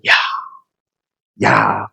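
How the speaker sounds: drawn-out wow of admiration; admiring gasp. Acted. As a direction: let out an awed "ya". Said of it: "감탄을 하는 거예요".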